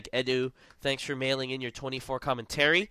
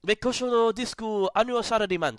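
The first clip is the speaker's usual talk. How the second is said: mock Japanese accent; 8 semitones higher than usual. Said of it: "something that the Japanese would say".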